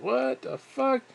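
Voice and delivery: high, slow, stretched-out what the fuck; startled disbelief. Retold or imitated. Waking to a stranger in bed, a high slow startled what the fuck. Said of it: "and it's like".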